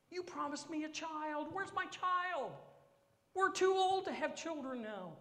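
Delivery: high, loud whining voice; whiny Abram. Acted. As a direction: whimper and moan to God. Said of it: "He's whining and complaining".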